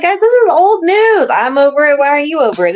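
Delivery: mock dismissive. Host mocking Boston's shrug about old news, high and airy. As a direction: play it airily like it's nothing. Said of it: "'cause he's like".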